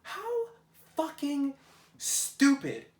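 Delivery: shrieked high and loud, word by word; exasperated outburst. Real, not acted. Finding dirty pots after the sink disaster, shrill exasperated outburst. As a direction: let it burst out in disbelief. Finds pasta left in pot.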